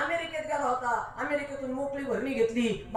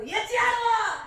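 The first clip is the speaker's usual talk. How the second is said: mock slogan shout; 11 semitones higher, slower than usual. Mocking twist of slogan.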